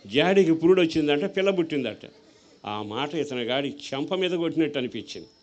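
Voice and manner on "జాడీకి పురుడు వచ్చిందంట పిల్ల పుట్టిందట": laughing through the punchline; louder, amused retelling. Real, not acted. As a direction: chuckle at the absurd claim. Preacher mocks absurd claim.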